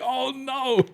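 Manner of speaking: mock panicked guy. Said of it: "He's like".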